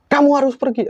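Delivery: very high-pitched commanding cry; mock hysterical order to leave; acted outburst. Possessed woman confronts the teller, imitated hysterical outburst.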